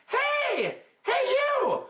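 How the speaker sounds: very high call from the treetop. Acted. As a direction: shout down the tree to the crocodile. Monkey calls from tree.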